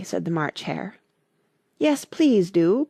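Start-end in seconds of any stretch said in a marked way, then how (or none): 1.8-2.9 slow, drawn-out plea; pleading. A narrator's reading. Alice begging the Dormouse for a story, pleading slowly. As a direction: coax the Dormouse gently. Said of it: "pleaded Alice".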